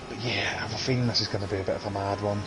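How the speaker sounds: fast, hushed whisper aside. Real, not acted. Commentator whispering a prediction of a mad race.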